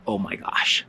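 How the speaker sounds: hushed, voiceless gasp of amazement; awed whisper. Retold or imitated. Viewer stunned by the play seen back to back, awed whisper.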